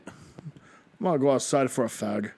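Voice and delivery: lightly put-on British voice; casual fake English accent; mock English accent. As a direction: play a Brit stepping out. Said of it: "That's the worst accent in the world".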